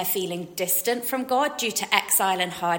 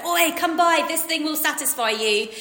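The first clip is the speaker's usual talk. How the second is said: high-pitched market-seller pitch; hawking it at passers-by; in-your-face call; 6 semitones higher than usual. Acted. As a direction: call out pushily like a street vendor. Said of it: "the in-your-face oi".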